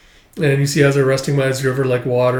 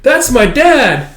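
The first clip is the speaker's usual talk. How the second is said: high, loud, drawn-out announcement; excited child; 11 semitones higher, 7 dB louder, slower than usual. Retold or imitated. Child announcing their father to the villagers, excited. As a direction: proudly call out like a child.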